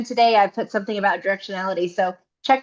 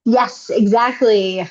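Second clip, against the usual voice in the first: ordinary talk, nothing marked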